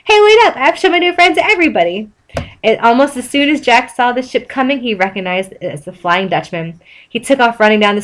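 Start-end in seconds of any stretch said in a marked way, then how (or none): 0.0-2.1 calling after. A narrator's reading. SpongeBob chasing Jack through town, high eager call. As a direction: shout after him, eager.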